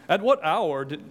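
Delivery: a hint of a lofty British reading; mock King's English. Preacher mocking the formal King's English reading. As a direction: read it in a mock posh British accent. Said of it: "They weren't even speaking the king's English".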